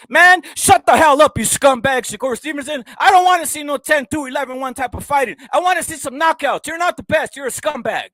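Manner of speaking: high, loud furious yelling; angry rant. Real, not acted. Vlogger yelling at Shakur Stevenson, high and furious. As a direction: scream him down. Ranting at fighter he despises.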